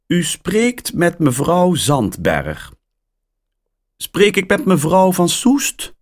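surprised caller on the line; woman's voice. A narrator's reading. Caller introduces herself.

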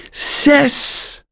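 a gleeful high cry; happy call. A narrator's reading. Mia thrilled by her dice roll, happy high call. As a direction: call it out with delight. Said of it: "roept ze blij".

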